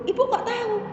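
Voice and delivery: high-pitched mock surprise; retold puzzled question. Speaker asks how the mother knows, retold surprise. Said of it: "Saya bertanya gitu".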